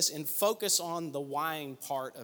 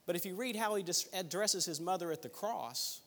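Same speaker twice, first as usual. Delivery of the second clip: ordinary talk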